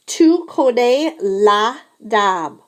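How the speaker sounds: Tu connais la dame? drawn out slowly with a falling end; slow model question. Shown as a demonstration. Teacher models the question slowly for students. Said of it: "the first thing we're gonna say is".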